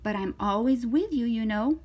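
lively, swooping story read; reading to children. Acted. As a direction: voice an eager, confident little girl. Reads to children, voicing Zoe.

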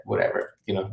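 ordinary talk

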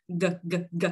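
"g" as a short voiced guh, throat vibrating; three short "g" sounds from the back of the tongue. Shown as a demonstration. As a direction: buzz the throat on each "g". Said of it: "lúc này cổ họng rung".

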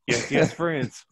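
high, loud, through laughter; a friend cracking up at his own joke; laughing mock French. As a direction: giggle the punchline out.

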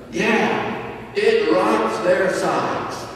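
normal talking voice

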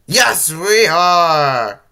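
loud, high, drawn-out cheer. A narrator's reading. Crew answers Lumpy about the adventure, cheering loudly. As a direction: cheer it slowly, stretching the words. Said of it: "The crew cheered again".

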